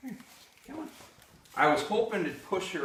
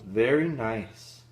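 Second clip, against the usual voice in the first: slow praise; 5 dB louder, much slower than usual. Real, not acted. Praising the dog slowly.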